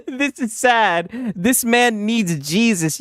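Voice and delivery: slow and mournful; slow solemn read. Retold or imitated. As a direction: drag it out like a sad eulogy. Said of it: "My boy said".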